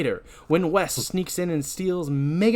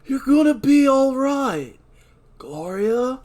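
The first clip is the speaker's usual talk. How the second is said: reassuring; 11 semitones higher, 7 dB louder, slower than usual. Acted. Dan comforts Gloria.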